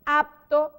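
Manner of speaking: apto with a clear labial P before the T. Shown as a demonstration. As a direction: say apto with the P fully sounded. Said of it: "Cuando nosotros decimos apto".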